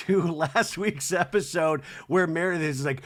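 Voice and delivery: usual speaking voice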